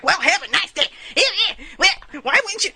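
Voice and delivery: high-pitched, exaggerated retold comeback; mock huffy retort. Retelling a toll booth spat, hammed-up and high. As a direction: act out a petty squabble, voice leaping up.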